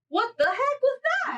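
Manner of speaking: startled cry. Retold or imitated. Retelling shock after sleep paralysis.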